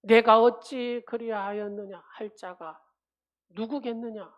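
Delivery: loud, fervent sermon delivery; preaching. Acted. Pastor preaching to the congregation, loud and fervent. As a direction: preach it out to the whole church.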